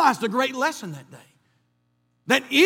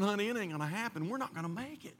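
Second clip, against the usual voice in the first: quiet resignation; 3 semitones lower, 14 dB quieter, faster than usual. Retold or imitated. Telling his wife to give up.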